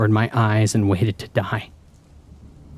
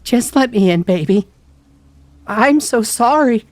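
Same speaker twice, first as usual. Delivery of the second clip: loud, very high tearful plea; crying, voice broken; 13 semitones higher, 6 dB louder than usual. A narrator's reading. Mom begging to be let in, crying. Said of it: "Her voice broke and she started to cry".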